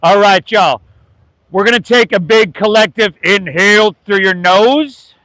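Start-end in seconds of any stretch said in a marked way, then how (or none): 1.5-5.1 yoga teacher leading a breath; guided breathing. Acted. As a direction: guide the class through each breath.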